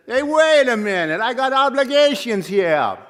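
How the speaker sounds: loud, high tough-guy New Jersey accent, hammed up; Sopranos New Jersey accent. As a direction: play a New Jersey mobster from The Sopranos objecting. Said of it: "in his best Sopranos accent".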